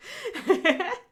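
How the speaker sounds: high-pitched giggle; real laugh. Real, not acted. After leaving the bread to David, amused high laugh. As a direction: let out a high little laugh.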